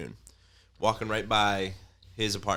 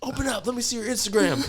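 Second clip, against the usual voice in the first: high, louder mock demand; 5 dB louder than usual. Jealous girlfriend at his door, high mock demand. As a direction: bang on the door and demand the phone.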